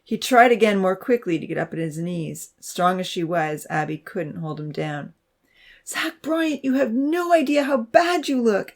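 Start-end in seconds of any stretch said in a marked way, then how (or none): 5.9-8.7 high, distraught scolding; high-pitched upset exclamation; crying out. A narrator's reading. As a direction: cry out at him in alarm. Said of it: "Abby cried".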